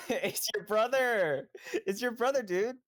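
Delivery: high, slow excited shout. Acted. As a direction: announce it with glee. Excited teasing announcement.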